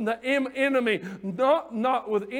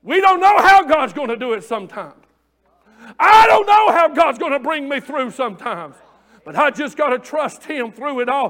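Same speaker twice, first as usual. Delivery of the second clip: loud, impassioned shouted preaching; full-force preaching; 3 semitones higher, 9 dB louder than usual. Real, not acted. Preacher urging congregation to trust God through it all, full-force preaching.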